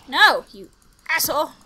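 real annoyance; angry. Real, not acted. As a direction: curse at the zombie. Yelling at zombie.

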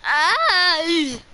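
a yelp where the voice cracks high; sudden cracked yelp. Real, not acted. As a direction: let the voice break on the shout. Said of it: "That was a voice crack".

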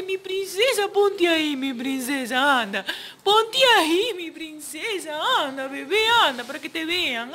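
hushed, high coaxing voice to a posing puppy; soft baby talk. Acted. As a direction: coax her quietly so she holds still. Coaxing dog to pose for photo.

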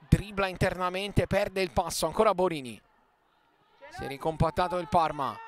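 ordinary talk

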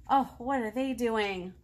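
ordinary talk

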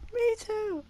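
high squeaky silly voice. Pretend. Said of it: "I'll make a squeaky ass voice".

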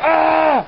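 high startled yelp; mock scared shriek; imitated startled yelp. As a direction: squeal it like a scared kid. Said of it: "the dude looks up and goes".

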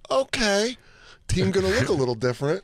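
high, resigned mock fan voice; high sing-song acceptance of a new team. Fan shrugging off a new lineup, high mock voice.

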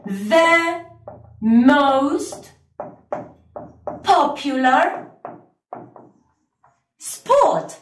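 slow English model, pausing between The, most, popular, sport; teacher modelling the answer for students; slow model sentence. Shown as a demonstration. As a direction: read it out slowly, word by word. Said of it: "vamos a decir".

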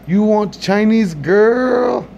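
high, very slow put-on voice of the waiter; mock accented offer. Comedian voices restaurant worker.

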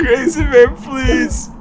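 high crying plea; desperate pleading. Real, not acted. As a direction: beg him, half sobbing. Friend losing fight.

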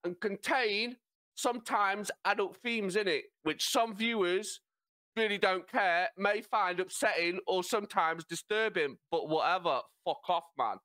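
cheeky, put-on British lad reading a disclaimer; exaggerated British accent. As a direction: ham up your own British accent. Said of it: "Do it in your accent".

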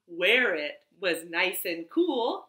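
ordinary talk, nothing marked

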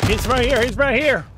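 high, loud alarm to teammates; urgent call-out. Real, not acted. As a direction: shout the warning twice, urgently. Warns teammates of enemy in game.

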